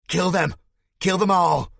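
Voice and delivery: very high, loud screaming chant; frenzied shout. Acted. Spike egging on the crowd, frenzied high shouting. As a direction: scream it in wild excitement. Jumping up and down ... overexcited.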